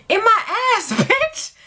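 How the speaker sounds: very high, cheeky punchline; imitating his shameless glee; mock gleeful brag. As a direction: crow the reveal in a high voice.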